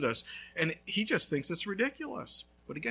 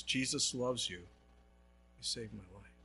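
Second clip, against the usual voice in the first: hushed awe; 7 semitones lower, 10 dB quieter than usual. Retold or imitated. Homeowner's testimony.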